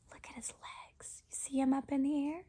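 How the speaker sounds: very soft, excited hush; whisper. Real, not acted. Pointing out Gerald's leap, whisper. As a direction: whisper with wonder.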